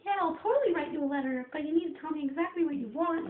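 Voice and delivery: high, loud therapist send-up; impersonated therapist. Retold or imitated. Therapist agreeing to write a letter, high-pitched impersonation. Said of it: "I like impersonating people".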